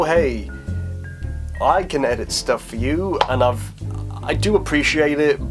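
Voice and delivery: ordinary talk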